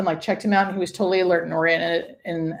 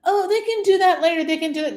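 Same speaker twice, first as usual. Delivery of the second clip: mocking, much higher voice; dismissive quote; 11 semitones higher, 4 dB louder than usual. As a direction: brush it off airily, imitating them. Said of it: "people are saying".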